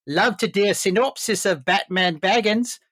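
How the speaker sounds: put-on jokey Kiwi accent, Peter Jackson; put-on New Zealand voice. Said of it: "a very objectionable New Zealand accent".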